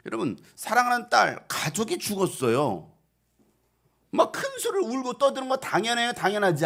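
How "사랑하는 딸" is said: ordinary delivery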